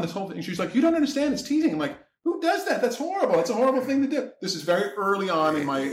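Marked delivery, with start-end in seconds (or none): 0.7-1.7 high-pitched imitation of her pleading; woman's protest. Acts woman defending her teasing. 2.2-4.3 very high-pitched retold indignation; scandalized outrage. Retells outraged reply in argument.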